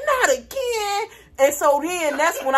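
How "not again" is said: high-pitched, drawn-out wail; hammed-up exasperated cry, stretched out; mock dismay. Hearing nephew was offered crack, high mock dismay.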